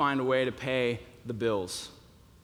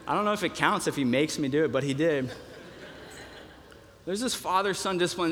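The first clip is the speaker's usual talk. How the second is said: normal talking voice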